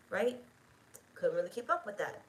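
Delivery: ordinary talk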